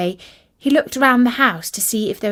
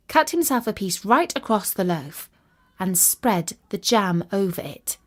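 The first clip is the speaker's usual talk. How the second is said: usual speaking voice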